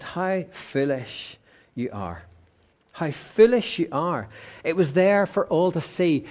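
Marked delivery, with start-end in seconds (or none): 0.0-2.2 a touch of Jesus, slow and hushed; a slow, quiet, sorrowful reproach; lightly voiced Jesus chiding softly; gentle rebuke. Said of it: "And so Jesus said to them".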